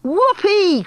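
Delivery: high, whooping cheer; delighted cry. A narrator's reading. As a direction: cheer like a thrilled follower. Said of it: "cried the witches, clapping their hands".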